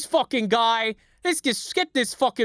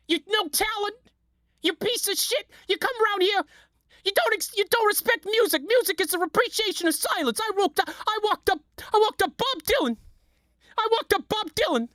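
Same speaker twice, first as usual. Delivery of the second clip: pitched way up, snobbish and scornful; high mock rant; 10 semitones higher than usual. Ranting at the imagined hack, in a high scornful voice. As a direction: sneer at the no-talent from up high. Ranting as a music snob figure.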